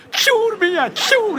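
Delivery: hammed-up scared shout; half-laughing yelp of fright; mock cry of fright. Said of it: "я закричал".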